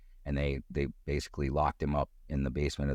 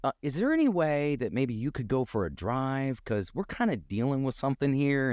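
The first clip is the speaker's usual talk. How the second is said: awkward polite request; 9 semitones higher than usual. Retold or imitated. Himself asking roommate to leave.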